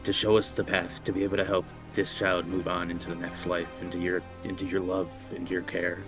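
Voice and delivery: reverent, hushed prayer. Acted. Player character praying to deity.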